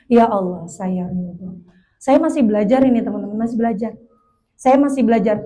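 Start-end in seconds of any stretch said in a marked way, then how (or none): 0.1-1.6 very soft, low acceptance; soft surrendering prayer. Acted. Speaker models telling Allah she accepts, hushed surrender. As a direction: let it sink to a hushed private prayer.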